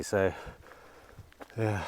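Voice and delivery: usual speaking voice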